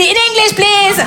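high, insistent teacher demand; prof d'anglais exigeante. Acted. Prof exige l'anglais du SDF. (prof qui exige, voix très aiguë)

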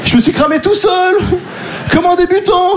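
high, wailing mock dismay; panicked inner voice. Stoned man realising he gave himself away, high comic wail. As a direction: lament in a squeaky head voice. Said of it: "Et là, je me dis".

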